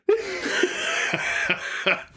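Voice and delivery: shrieking high laugh. Real, not acted. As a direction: burst out laughing. Big laugh at joke.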